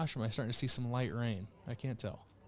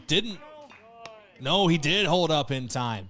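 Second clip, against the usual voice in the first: excited; 2 semitones higher, 6 dB louder than usual. Real, not acted. Excited call of a check swing.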